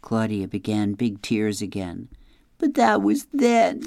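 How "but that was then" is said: high, tearful wail; a mild sob on the words; lightly voiced girl crying her answer; sobbing. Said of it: "she sobbed".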